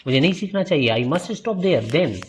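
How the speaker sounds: high-pitched, lightly acted dismay; mild protest. Pupil told his lessons must stop there, in dismayed protest.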